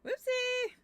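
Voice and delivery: high, loud playful yelp; playful whoopsie. Real, not acted. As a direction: squeal it like a little slip. Falls off edge in game.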